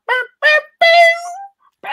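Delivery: high sing-song beep beep; imitated beeping. Imitating the room's music.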